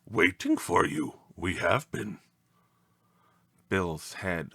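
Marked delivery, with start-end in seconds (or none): 0.1-2.2 otherworldly ancient creature; odd, ancient visitor's voice; strange ancient voice. A narrator's reading. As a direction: speak as a strange, ancient being. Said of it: "A strange, ancient voice said".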